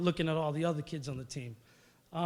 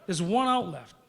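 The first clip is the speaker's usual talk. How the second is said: loud, raised-pitch indignant protest; worked-up, pleading mock outburst; retold exasperation; 5 semitones higher, 5 dB louder than usual. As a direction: replay an outraged complaint to the coach. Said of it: "I'm still out of my mind".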